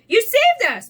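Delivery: joyful storybook praise; grateful exclamation. A narrator's reading. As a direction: exclaim it to the rescuer. Pooh gratefully praises Piglet.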